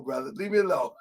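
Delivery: usual speaking voice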